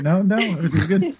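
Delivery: high, breezy fake reassurance; mock reassurance. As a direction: brush it off brightly.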